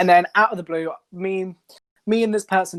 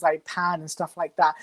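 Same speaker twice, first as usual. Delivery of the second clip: ordinary talk, nothing marked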